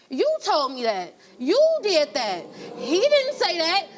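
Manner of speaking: put-on child talking back. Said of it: "in his face, like this".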